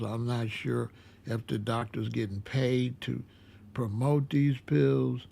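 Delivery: normal talking voice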